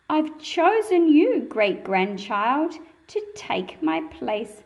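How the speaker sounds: normal talking voice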